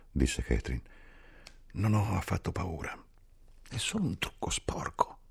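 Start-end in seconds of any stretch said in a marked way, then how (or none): 1.7-5.2 higher, softer whisper of a dying woman; frail whisper. A narrator's reading. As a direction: whisper it bravely, fading. Catherine morente, sussurro. (sussurro acuto e fioco)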